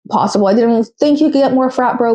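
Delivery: normal talking voice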